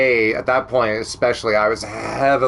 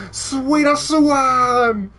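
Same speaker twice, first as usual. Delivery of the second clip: drawn-out shout; 11 semitones higher, much slower than usual. Real, not acted. Yelling Sweeta's name, slow.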